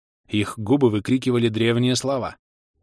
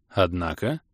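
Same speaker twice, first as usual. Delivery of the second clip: lower, softer prompting question; questioning echo; 4 semitones lower, 4 dB quieter, slower than usual. A narrator's reading. Visitor prompts the gnome to go on, questioning. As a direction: echo it back softly as a question.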